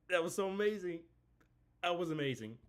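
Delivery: ordinary talk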